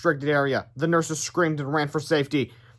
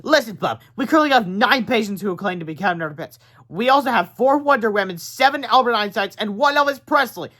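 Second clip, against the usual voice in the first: higher, louder, brusque nurse; nurse's voice, higher, condescending; 6 semitones higher, 4 dB louder than usual. A narrator's reading. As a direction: lecture them like a bored nurse.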